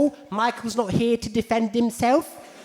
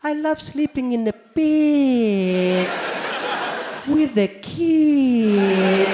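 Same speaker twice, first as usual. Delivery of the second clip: creepy guy Michael voice; 4 semitones higher, much slower than usual. Retold or imitated. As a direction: drawl it slowly like Michael. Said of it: "some guy comes up to me".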